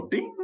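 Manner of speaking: high sung ding like a bell. Pretend. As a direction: chime it out high. Imitates the bell for points.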